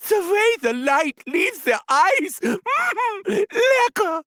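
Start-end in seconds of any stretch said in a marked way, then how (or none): 2.4-3.2 gleeful laugh. Acted. Gleeful laugh after kill.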